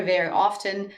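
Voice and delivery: usual speaking voice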